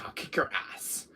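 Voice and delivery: breathy whispered threat under the breath. Acted. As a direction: hiss the threat quietly. Threat said twice, differently.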